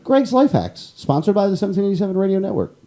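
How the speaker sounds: high, brisk radio promo voice; mock ad announcer. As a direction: announce it like a sponsored segment.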